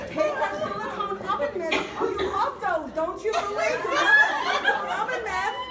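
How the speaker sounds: high, loud, laughing mock coaxing; mock sweet sarcasm. Wife mocking husband about oven mitts, high laughing sarcasm. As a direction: tease him like a child. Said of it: "I go".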